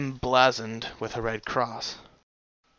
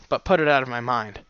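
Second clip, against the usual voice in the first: ordinary delivery, nothing marked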